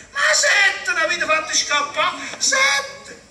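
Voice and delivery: loud, rising, furious outburst; outraged shout. Acted. Felice blasting the direttrice over seven escaped madmen, outraged. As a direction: hurl the word sette at her.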